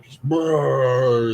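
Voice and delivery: a wet gagging brrr, like throwing up; retching sound. Retold or imitated. Retelling being sick in the school bathroom, a retching brrr. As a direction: mimic being sick into the toilet.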